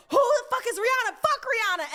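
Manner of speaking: very high, loud, bewildered yell; mom shouting. Retold or imitated. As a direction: scream the question like an outraged mom. Mom furious.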